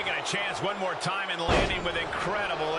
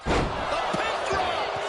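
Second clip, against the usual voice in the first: high, slow drawn-out call; excited call; 13 semitones higher, 3 dB louder, much slower than usual. Acted. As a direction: stretch the call for drama. Commentator calls the pin.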